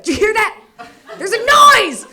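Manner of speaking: high, loud panicked yell; mock manic alarm; frantic shout. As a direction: yell it like the noise is real. Manic panic at phantom noise.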